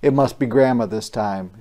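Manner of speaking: usual speaking voice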